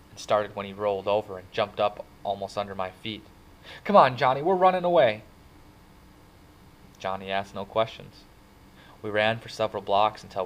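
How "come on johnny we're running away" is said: loud, high, urgent order; rally him to flee now; urgent call. A narrator's reading. Kid urging friend to run away, urgent shout.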